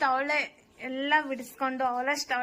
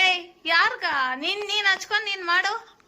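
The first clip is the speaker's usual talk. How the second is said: loud, high scolding; raised-voice telling-off; scolding retort; 6 semitones higher, 8 dB louder than usual. Real, not acted. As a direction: snap back and refuse loudly. Scolding back over the chopping task.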